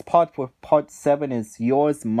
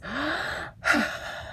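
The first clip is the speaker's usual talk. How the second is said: sharp high sudden gasp, mocking; imitated gasp; 13 semitones higher, 8 dB quieter than usual. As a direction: suck in air like a shocked cartoon sponge. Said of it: "SpongeBob does this".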